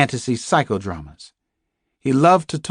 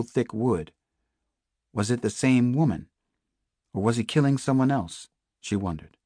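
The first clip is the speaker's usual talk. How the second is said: ordinary delivery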